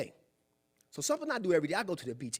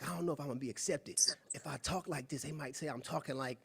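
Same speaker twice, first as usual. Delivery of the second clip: softer, hesitant worried inner voice; insecure fear; 2 semitones lower, 8 dB quieter than usual. Retold or imitated. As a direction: voice the fear of not being accepted, quietly. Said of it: "you have fear".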